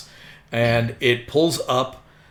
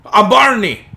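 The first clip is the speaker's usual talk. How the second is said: loud, high shouted introduction; Barney the driver; 8 semitones higher, 8 dB louder than usual. Acted. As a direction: bellow your name as the driver. Driver announces his name.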